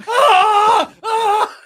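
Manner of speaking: high, very loud scream; imitated screaming. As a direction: scream it twice, full volume. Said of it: "He's just screaming for, like, ten minutes straight".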